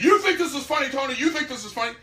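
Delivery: mock an angry boss yelling across a meeting; retold hollering. Administrator hollers at the narrator's wife, imitated angry yell. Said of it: "A man hollering at my wife".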